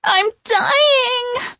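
high, loud melodramatic wail. A narrator's reading. Sarah soaked by sprinklers, wailing. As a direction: wail it like a doomed witch. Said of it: "Mary and Sarah wailed".

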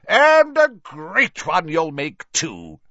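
big, slow, high-starting cheer; Slughorn booming. A narrator's reading. Jovial professor backs his pupil's ambition, booming loudly. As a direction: boom it out with hearty encouragement. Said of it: "boomed Slughorn".

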